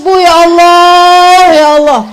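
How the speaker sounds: drawn-out, loud, half-sung "ya Allah"; wailing lament. Acted. Preacher wailing at the mothers over mildewed prayer clothes, drawn-out and loud. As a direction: wail it slowly to the mothers in dismay.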